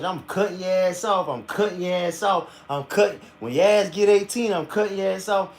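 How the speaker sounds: hammed-up shouting threat, rising as she goes; imitating threatening mama. Son retelling his mama's threat to cut him off, mock yelling. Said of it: "My mama used to threaten".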